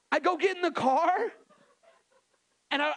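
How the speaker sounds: normal talking voice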